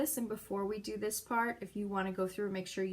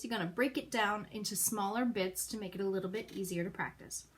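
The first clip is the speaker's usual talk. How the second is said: ordinary talk, nothing marked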